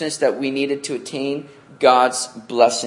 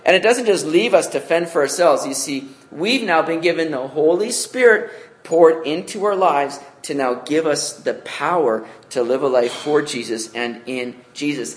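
ordinary talk, nothing marked

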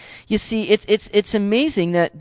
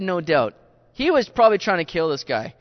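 ordinary delivery

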